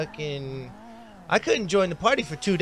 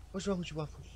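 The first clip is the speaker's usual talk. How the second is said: ordinary talk, nothing marked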